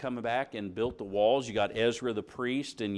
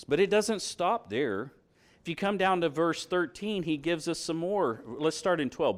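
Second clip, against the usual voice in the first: normal talking voice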